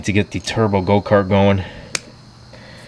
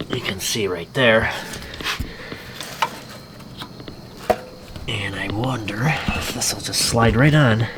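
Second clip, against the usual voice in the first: whispering; 4 dB quieter than usual. Real, not acted. Close-up work on the engine.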